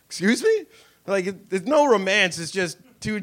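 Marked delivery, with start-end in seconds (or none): 0.1-0.7 much higher, startled excuse me; shocked. Retold or imitated. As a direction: caught off guard, squeak it out. Shocked reply to crude invitation.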